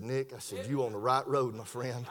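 very low, soft, tender voice; hushed reassurance. Retold or imitated. Preacher reassuring a repentant man, hushed and low. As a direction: reassure him gently, far down in pitch.

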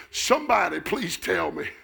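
high, shouted plea building in intensity; full-force preaching. Real, not acted. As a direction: cry out to the congregation, stretching the climactic phrases.

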